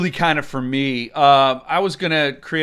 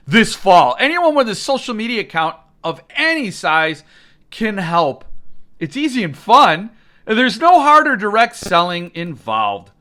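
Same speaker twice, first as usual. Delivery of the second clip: mock promo read; 3 semitones higher, 4 dB louder than usual. Reading the newsletter aloud mockingly.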